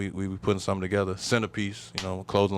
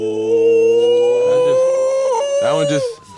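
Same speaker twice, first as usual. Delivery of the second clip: much higher, louder squeal; excited squeal; 12 dB louder, much slower than usual. Real, not acted. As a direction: squeal it out in protest. Squealing in amused protest.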